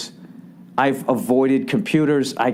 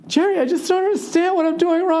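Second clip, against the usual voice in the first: very high falsetto whine; distressed woman impression. Jerry's woman pleading with him that weekend, upset falsetto. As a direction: plead in a squeaky mock-female voice.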